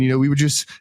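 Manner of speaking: normal talking voice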